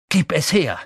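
loud, firm demand; calling out. A narrator's reading. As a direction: call the order out forcefully. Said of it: "rief Harry".

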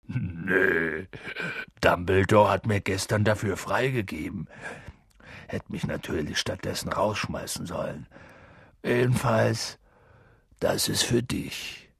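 low, muffled big-man voice; Hagrid, subdued. A narrator's reading. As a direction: speak gently, just recovered from crying. Narrator voices Hagrid, calmer now.

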